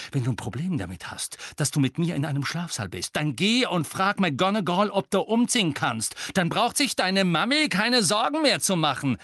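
building from heated retort to high, loud outburst; boiling anger. A narrator's reading. Roommates quarrelling over a mother, increasingly furious. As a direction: let the temper climb to a shout. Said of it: "seine Wut kochte so schnell hoch".

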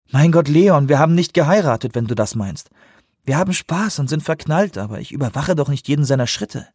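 höher, leicht entrüstet; junge Frau, genervt. A narrator's reading. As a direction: eine ungeduldige junge Frau. Delaware als Figur gesprochen. (leicht gesprochene, genervte Frau)

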